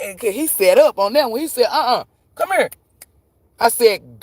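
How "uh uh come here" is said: high-pitched mock call to a dog; imitated owner calling. Owner calling dog back, retold.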